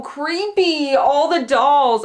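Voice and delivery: creeped out. Real, not acted. Dolls creep her out.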